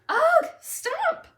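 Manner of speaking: put-on high, loud exasperated child shout; frustrated kid yell. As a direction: groan then yell stop. Child quarrel escalates.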